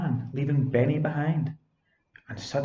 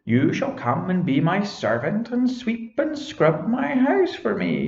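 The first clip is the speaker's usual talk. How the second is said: high, shrill witch voice, louder at the end; screeching witch; 8 semitones higher, 4 dB louder than usual. Acted. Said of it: "screeched the green-eyed witch".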